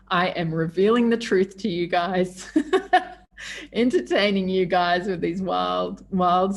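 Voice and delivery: laughing through the words. Real, not acted. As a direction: giggle as you speak. Laughs while describing herself.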